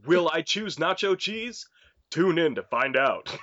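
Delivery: cliffhanger radio host, hammed up. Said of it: "a radio D J in everyday life".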